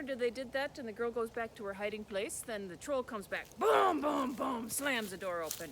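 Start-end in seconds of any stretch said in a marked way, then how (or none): 3.0-4.7 booming, louder stomp sounds; giant footsteps. Acted. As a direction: stomp out the troll's footfalls. Troll stomping back home.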